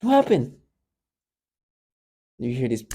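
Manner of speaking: normal talking voice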